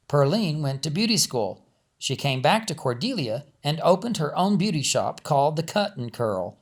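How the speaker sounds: ordinary delivery, nothing marked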